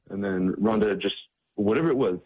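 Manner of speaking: ordinary talk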